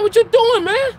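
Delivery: high, loud challenge; angry shout. Retold or imitated. Man shouting at the drunk neighbor in his doorway, angry shout. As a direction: yell it right in his face.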